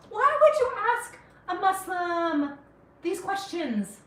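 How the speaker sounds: slowed, incredulous scolding; exasperated disbelief. Real, not acted. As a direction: ask it like it's absurd. Indignant rhetorical question.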